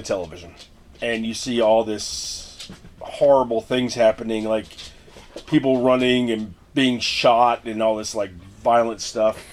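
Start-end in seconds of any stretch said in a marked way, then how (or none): none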